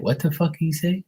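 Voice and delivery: confused listeners. Acted. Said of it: "They be like".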